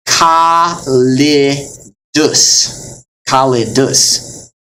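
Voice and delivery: kalidus slowly as ka-li-dus; split syllables. Shown as a demonstration. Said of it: "is how you would say it".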